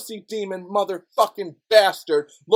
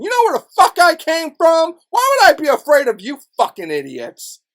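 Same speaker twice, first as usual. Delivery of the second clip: loud, higher furious yell; angry shout; 8 semitones higher, 7 dB louder than usual. Real, not acted. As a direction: bark it at them full force. Angry rant at critics.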